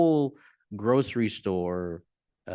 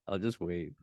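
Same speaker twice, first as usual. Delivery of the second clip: usual speaking voice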